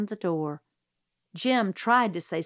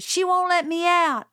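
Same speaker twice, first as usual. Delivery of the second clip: put-on shouting child; 10 semitones higher, 6 dB louder than usual. A narrator's reading. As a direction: drag it out, yelling to be heard. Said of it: "shouted Francis".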